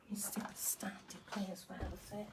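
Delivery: soft unvoiced whisper. Real, not acted. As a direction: whisper it so nobody hears. Quietly setting up equipment.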